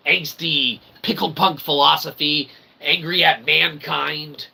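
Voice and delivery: ordinary delivery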